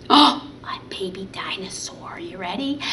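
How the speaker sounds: put-on soft excited whisper to the kids; hushed whisper building suspense; secret whisper. Secretive reveal of dinosaur puppet.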